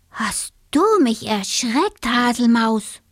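a touch of fright. A narrator's reading. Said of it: "rief der kleine Siebenschläfer".

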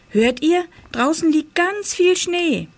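put-on high-pitched, eager boy; excited announcement. A narrator's reading. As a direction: wake them up with thrilling news. Excited boy wakes his parents. (aufgeregt und hoch gerufen)

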